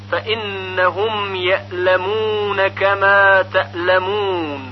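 slow, measured verse with held nasal n; easing each hamza into ل in یعلمون and تعلمون; Quranic recitation. Shown as a demonstration. Teacher reciting the verse correctly, slow tajweed recitation. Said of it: "صحیح اون این هست".

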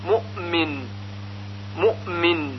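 مؤمن as mu'min, hamza flowing into م; reciters' hamza. Shown as a demonstration. Teacher echoing most reciters, lightly released hamza in مؤمن. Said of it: "اکثر قراء هم به همین صورت".

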